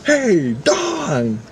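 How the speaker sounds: high, drawn-out call across a room; screamed out. Retold or imitated. Coworker calling Dawn to start the staged test, screamed out. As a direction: holler it theatrically, stretching the name. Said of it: "and then I scream out".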